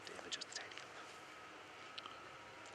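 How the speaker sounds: slow hushed whisper; golf commentator. Real, not acted. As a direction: whisper it slowly. Hushed commentary during a tap-in.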